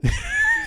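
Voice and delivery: short squealing burst of laughter; high-pitched laugh. Real, not acted. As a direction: crack up high at your own jab. Laughs at own jab.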